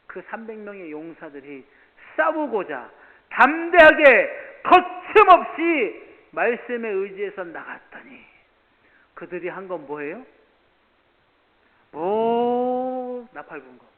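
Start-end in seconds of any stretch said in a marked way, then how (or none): 2.2-8.2 loud, high impassioned preaching; full-force preaching. Acted. Pastor preaching loudly to the congregation about David. 11.9-13.9 slow, stretched and louder; drawn-out answer. Acted. Pastor answering his own question, slow and drawn out.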